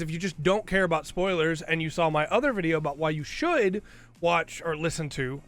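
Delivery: ordinary talk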